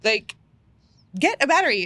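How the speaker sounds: retold helpless plea on the phone; pleading for help. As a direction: beg her partner for a battery. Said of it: "I was like".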